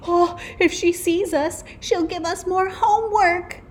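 put-on high, loud panicky child voice; alarmed kids. A narrator's reading. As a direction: warn your friends in a high-pitched fright. Children dread the mean teacher.

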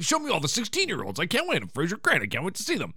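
teasing, overeager impression; mock excitement. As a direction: imitate someone who can barely wait. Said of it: "you're super excited to judge".

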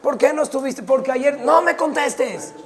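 angry mother, high and loud; scolding yell. Acted. Mamá le grita al niño, regañándolo a gritos. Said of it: "La mamá le grita al niño".

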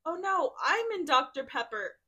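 hammed-up dismay, high and loud; exclaimed. Reader acts out the clumsy drinker's realization, loud mock dismay. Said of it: "looked around and exclaimed".

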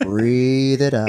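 drawn-out, steadying instruction; slow coaching. Real, not acted. Friend coaches a coughing man to breathe, slow and steadying. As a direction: talk someone through a breath, very slowly.

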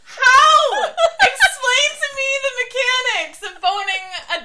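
high-pitched laughing question. Real, not acted. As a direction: ask through laughter. Laughing at the phrase.

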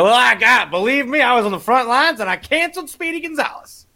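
high, strained mock-heroic voice; put-on high voice, proudly reporting back; self-important husband. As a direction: boast like a hammed-up war veteran. Acts imagined husband boasting to wife.

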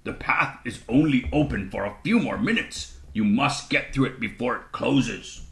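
lightly voiced toad, pressing them on; a hint of an urgent creature guide; urging toad character. A narrator's reading. Toad warns children the path will close, lightly voiced urging.

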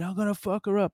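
high, strained mock yell; mock scream. Ex-cop imitates woman screaming a threat, high. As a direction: threaten her high and frantic. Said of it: "And she kept screaming".

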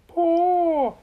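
high, loud, held-out word; magical command. A narrator's reading. Little Miss Magic orders the coffee pot, high drawn-out command. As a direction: sing out the order on one high note.

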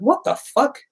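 disbelieving yelp, pitch shooting up; stunned outrage at being refused; shocked disbelief. Retold or imitated. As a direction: squeak it out very high, stunned.

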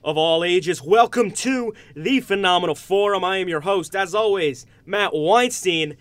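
booming ring announcer intro; grand entrance announcement. Acted. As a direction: announce the show like a main event. Host opens show with announcer intro.

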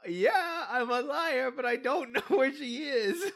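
high voice cracking into laughs; half-crying, half-laughing admission; teary confession. Acted. Admitting the lie to a friend, teary and laughing. Character admits lying, near tears.

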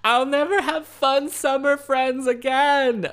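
high, loud, slow theatrical lament; mock melodramatic wail. Driving home emotional, a high loud mock lament. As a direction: wail it like a tragic heroine. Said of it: "I was just there like".

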